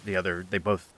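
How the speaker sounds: ordinary talk, nothing marked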